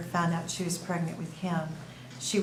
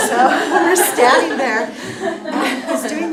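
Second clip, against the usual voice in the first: louder words with laughter running through them; laughing while talking; 7 semitones higher, 14 dB louder than usual. Real, not acted. Grandmother retelling the ultrasound moment, laughing through words. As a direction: talk through your laughter.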